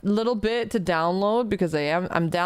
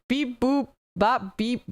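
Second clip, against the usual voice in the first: robot hymn. Pretend. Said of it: "This is a favorite hymn of my mother".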